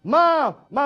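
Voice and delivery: loud, nagging cartoon-kid calls; whiny Stewie. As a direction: pester mom over and over like a cartoon kid. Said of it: "I love little Stewie".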